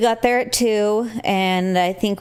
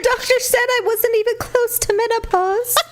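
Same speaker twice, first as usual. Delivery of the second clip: mock high voice; 13 semitones higher than usual. Mock voice joke.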